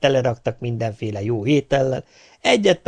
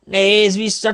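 called-out taunt; 9 semitones higher, 4 dB louder, slower than usual. Acted. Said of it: "kiabál utána az ördögök királya".

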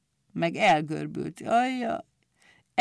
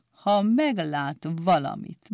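usual speaking voice

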